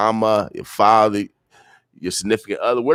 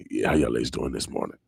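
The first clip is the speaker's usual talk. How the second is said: lower, softer take of the morning greeting; Papa Gator voice, softer take; 10 semitones lower, 7 dB quieter than usual. Retold or imitated. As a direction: lay it back, low and soft like Papa Gator. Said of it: "That's how Papa Gator should say".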